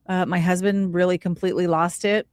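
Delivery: ordinary delivery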